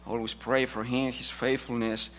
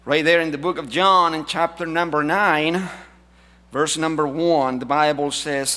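preaching; 3 semitones higher, 7 dB louder than usual. Acted. Announces scripture passage.

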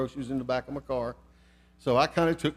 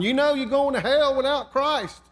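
high, loud call across the parking lot; hollering; 10 semitones higher, 4 dB louder than usual. Retold or imitated. Preacher keeps hollering after the man crossing the lot. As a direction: shout it after him as he walks off. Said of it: "I kept hollering".

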